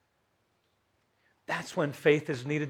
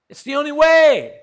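high, loud proclamation; preaching emphasis; 12 semitones higher, 12 dB louder than usual. Acted. Preacher to congregation, full-voiced proclamation. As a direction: declare it to the whole room.

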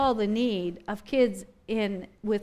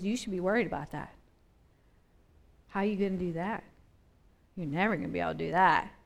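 softer, lower, fast nagging voice; doubting voices; 6 semitones lower, 5 dB quieter, much faster than usual. Retold or imitated. Preacher voicing the world's doubts, lower, soft and fast. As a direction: murmur worries in his ear. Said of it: "the voices that I hear".

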